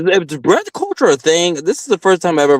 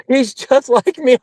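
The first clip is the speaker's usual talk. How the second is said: mocking quote; 9 semitones higher than usual. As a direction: parrot his claim in a much higher, dragged-out voice.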